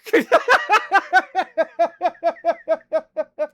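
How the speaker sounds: high real laughter that keeps going; helpless real laughter; long loud laugh. Real, not acted. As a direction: fall apart laughing. Laughing at her own swearing.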